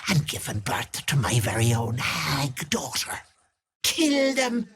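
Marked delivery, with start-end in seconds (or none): 3.8-4.7 high barked kill order; high hissing kill order; high vicious command. Acted. Commands attack.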